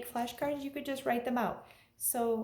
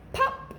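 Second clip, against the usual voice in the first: high sharp "pop!"; cheerful high pop noise; popping sound effect; 14 semitones higher, 4 dB louder than usual. Acted. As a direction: make the button pop with a bright squeak. Button pops off in story.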